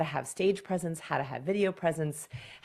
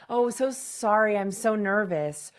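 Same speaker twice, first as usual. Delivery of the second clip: mock flustered, raised-pitch apology; nervous apology; 4 semitones higher, 4 dB louder than usual. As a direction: act out a nervous presenter apologizing. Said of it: "they apologize. They say".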